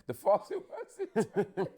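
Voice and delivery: laughing. Real, not acted. Repeating line, amused.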